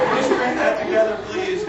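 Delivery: loud high mock plea; hammed-up strained request; mock pleading. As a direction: call out begging to the booth. Mock asking engineer.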